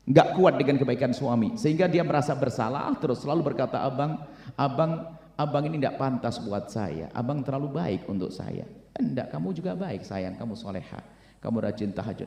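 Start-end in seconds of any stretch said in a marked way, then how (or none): 3.8-8.7 soft pleading wife. Acted. Wife confessing guilt to husband. 9.0-12.3 soft reassuring husband. Acted. Husband reassuring his wife.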